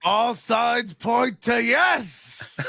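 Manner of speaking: drawn-out, grandly hammed-up psychic proclamation; slow mystic announcer stretching every word; Karnak. Comic doing a psychic bit for the room, grand slow proclamation. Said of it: "that's a great Karnak".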